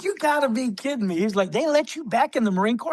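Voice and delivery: mock astonishment; incredulous disbelief. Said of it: "he was like".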